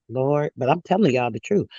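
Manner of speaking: ordinary talk, nothing marked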